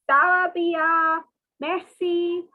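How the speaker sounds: slow Ça va bien, Merci as meh-see; modelled French reply. Shown as a demonstration. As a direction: model the answer for the class.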